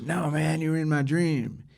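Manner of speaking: a hint of a raspy old rocker, insisting. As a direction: lightly play the old bluesman insisting. [name] voiced insisting on dream.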